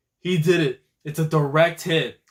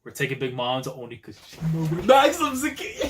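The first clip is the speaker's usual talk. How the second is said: ordinary talk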